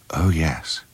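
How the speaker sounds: hushed, near-whispered royal reply; Princess Diana. Acted. Princess answering the actor about the show, hushed imitation. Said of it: "She said".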